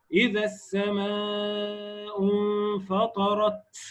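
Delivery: slow chanted إذا السماء انفطرت with an overdone final ت; faulty over-hissed recitation. Retold or imitated. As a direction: chant it, then turn the ت of انفطرت into a ts hiss. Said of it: "وده لحن". (تلاوة ملحونة بمبالغة في همس تاء انفطرت)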